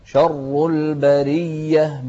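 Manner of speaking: شر chanted slowly with a thick doubled r; slow recitation, full heavy r in شر. Shown as a demonstration. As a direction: thicken and hold the r in شر. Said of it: "شوف التفخيم في شر".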